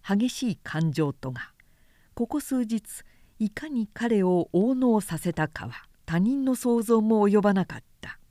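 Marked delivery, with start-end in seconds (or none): none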